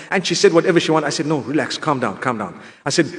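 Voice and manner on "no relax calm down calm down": calming reassurance. Retold or imitated. Soothing the apologising woman.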